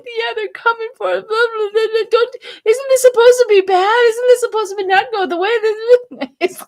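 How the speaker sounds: high, teary mock sob; hammed-up weepy whine, near tears; mock crying. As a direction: whimper it like he's about to cry. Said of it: "looks like he's about to cry".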